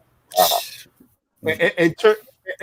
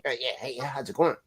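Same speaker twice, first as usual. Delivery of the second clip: imitating brother; 6 semitones lower, 11 dB quieter than usual. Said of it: "he called me".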